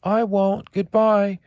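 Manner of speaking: put-on high, loud child's goodbye; little boy calling out. Little boy running off calls goodbye to the alligator, high and loud. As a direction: call it over your shoulder as you run. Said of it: "cried the little boy".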